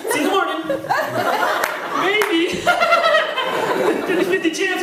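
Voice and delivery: high, teasing creepy goodnight; ominous goodnight. Pretend. Grandma saying goodnight to the child, in a high teasing voice. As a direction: tease the child that morning may not come.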